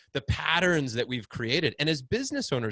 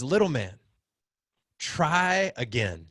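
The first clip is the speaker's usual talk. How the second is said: slow encouraging coach; 3 semitones higher, 3 dB louder, slower than usual. Retold or imitated. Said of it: "he goes".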